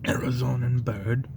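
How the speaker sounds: hushed, slow wildlife narrator whisper; nature documentary. Acted.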